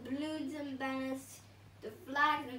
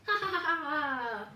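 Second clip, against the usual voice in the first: loud mock villain laugh; hammed-up evil laugh; evil cackle; 7 dB louder than usual. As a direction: cackle like a cartoon baddie. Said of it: "Cackled the bee".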